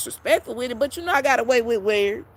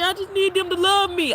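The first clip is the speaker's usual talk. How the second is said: imitating a pleading client; 8 semitones higher than usual. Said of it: "You tell me".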